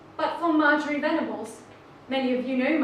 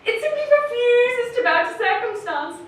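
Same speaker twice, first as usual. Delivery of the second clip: impassioned, higher and louder, building to a shout; rousing climax; 5 semitones higher, 9 dB louder than usual. Acted. Speaker rallies fellow cactus lovers, building to a triumphant shout.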